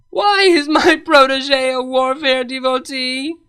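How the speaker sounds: head against the wall, crying out in despair; anguished wail. A narrator's reading. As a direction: wail it to the heavens. Celestia slams head on wall.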